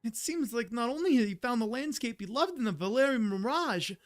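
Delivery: high-pitched, bouncy game companion voice; Paimon. Acted. Streamer reads game companion's dialogue, high gag voice. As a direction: pitch it up high with a sing-song swing. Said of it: "while I'm, like, reading Paimon's dialogue".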